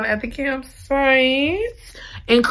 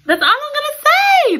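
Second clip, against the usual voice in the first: very high, loud "That's all I'm gonna say"; squealed tease; 5 dB louder than usual. Real, not acted. Reader teasing viewers about the ending, squealing. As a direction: shriek it playfully.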